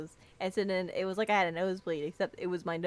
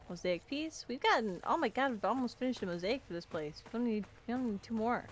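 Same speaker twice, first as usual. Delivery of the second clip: ordinary delivery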